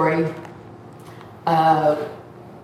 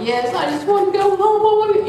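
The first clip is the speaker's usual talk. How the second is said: very high, whiny begging; hammed-up high-pitched plea; mock wailing plea; 13 semitones higher, 3 dB louder, faster than usual. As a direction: whine it like a pleading child. Retold begging, answering God.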